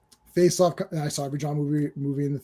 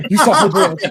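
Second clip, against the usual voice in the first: louder, laughing imitation of awe; mock amazement; 8 dB louder than usual. Classmate learns he finally watched the film, mock amazed. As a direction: play up a classmate's astonishment. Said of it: "it's like you lost your virginity".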